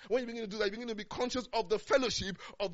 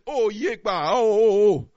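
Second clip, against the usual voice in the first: loud anguished oh, oh, oh; loud retold cry of distress; panicked outcry; 15 dB louder, slower than usual. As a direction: cry out as if overwhelmed with fear. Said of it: "I would have said".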